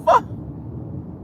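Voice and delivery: breathy burst of laughter. Real, not acted. Host tickled by own impression, breathy laugh. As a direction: break up laughing after the act.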